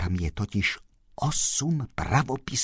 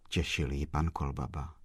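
usual speaking voice

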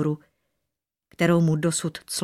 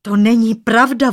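loud, higher cry of protest; alarmed shout; 4 semitones higher, 9 dB louder than usual. A narrator's reading. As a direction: call out in startled denial. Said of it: "volal polekaný mládenec". (polekané volání)

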